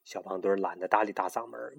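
usual speaking voice

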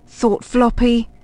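plain reading voice

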